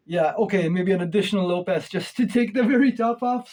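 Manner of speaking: usual speaking voice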